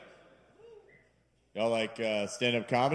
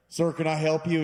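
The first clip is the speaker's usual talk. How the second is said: store clerk; 5 semitones higher, 6 dB louder than usual. Retold or imitated. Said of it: "approaches you and goes".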